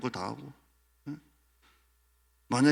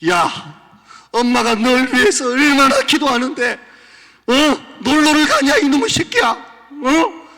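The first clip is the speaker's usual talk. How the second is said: much higher, very loud shriek; furious mother shriek; 13 semitones higher, 16 dB louder, slower than usual. Retold or imitated. Mother shrieking at a child skipping church. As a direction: scream at the child going out to play.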